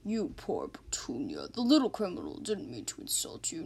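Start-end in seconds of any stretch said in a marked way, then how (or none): 0.1-1.5 low, slow, whispered comfort; cooing to a car. Acted. Officer comforts the insulted old car, whispering low and slow. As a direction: soothe the old car gently.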